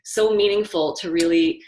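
real emotion breaking through with a laugh; fast, teary laugh of a runner moved by the memory; getting emotional. Real, not acted. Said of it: "I'm getting emotional".

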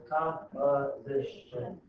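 very slow, counting out the syllables of "composition"; syllables counted out. Shown as a demonstration. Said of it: "I don't know if I did that syllable right".

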